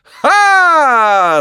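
very high loud exclamation; shouted clown cry. A narrator's reading. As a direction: blast it to the arena. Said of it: "закричал хозяин".

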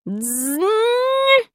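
high, rising imitation of breaking glass; ringing crash sound. A narrator's reading. Portrait glass breaking behind the boy, ringing imitation. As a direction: voice a drawn-out ringing jingle. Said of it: "раздался звук за моей спиной".

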